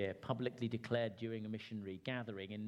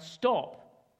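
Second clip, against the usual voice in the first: cry out; 8 semitones higher, 8 dB louder than usual. Retold or imitated. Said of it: "I cry out".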